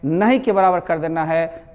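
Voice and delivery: usual speaking voice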